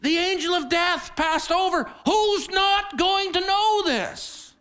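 loud, high fervent preaching. Acted. Preacher booming to the congregation, fervent. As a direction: drive it home to the congregation.

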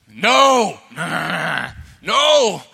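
hammed-up whiny bawling, rising on the long cries; wailing little brother. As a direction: wail in protest like a tormented kid. Little brother resists humiliating confession.

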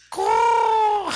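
loud high squawking caw; bird caw. Pretend. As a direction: shriek a crow's caw. Bird call at riffing.

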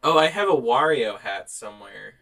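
ordinary talk, nothing marked

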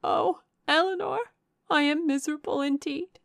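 high, loud cry of misery; anguished exclamation. A narrator's reading. Miserable sister exclaiming to Eleanor before sobs take her voice, anguished. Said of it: "exclaim in the anguish of her heart".

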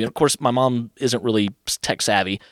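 ordinary delivery

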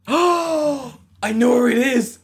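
loud, high, gasping delight; real excited outburst. Real, not acted. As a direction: burst out thrilled, voice way up. Guesses the beloved place with delight.

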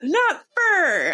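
mock-stern parents fixing "fur" to "for"; mimicking parents correcting. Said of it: "they were like".

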